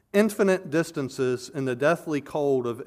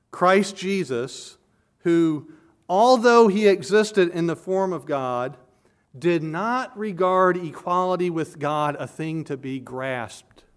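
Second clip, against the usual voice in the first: scripture reading; 4 semitones higher, 4 dB louder than usual. Acted. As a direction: read the verse out with weight.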